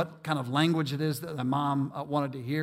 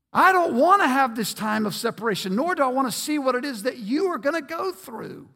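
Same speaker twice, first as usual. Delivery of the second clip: pleading God; 8 semitones higher, 6 dB louder than usual. Acted. God voiced, pleading with people.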